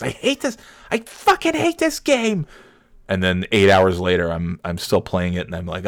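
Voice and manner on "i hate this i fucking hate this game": mock yell. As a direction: act out screaming at a video game. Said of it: "I yell at the video games".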